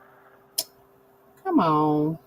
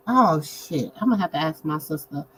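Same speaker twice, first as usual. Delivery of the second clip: ordinary talk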